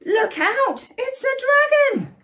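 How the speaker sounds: high, loud storybook shout; high-pitched cry of alarm; alarmed warning cry. A narrator's reading. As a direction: call out a warning in mock panic.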